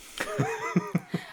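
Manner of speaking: short high burst of laughter; real amused laugh. Real, not acted. Laughs at own joke.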